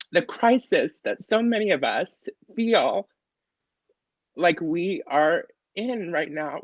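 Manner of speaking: fighting back tears as she speaks; real emotion breaking through; getting emotional. Real, not acted. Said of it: "Sorry, I'm getting emotional".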